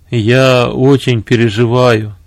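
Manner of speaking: ordinary delivery, nothing marked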